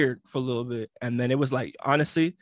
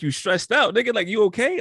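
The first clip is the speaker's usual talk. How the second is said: quoting his friend's worried text, pitched up and louder; 7 semitones higher, 5 dB louder than usual. Retold or imitated. Friend texting him at the party, mock worried and raised. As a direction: play the concerned homegirl checking on him.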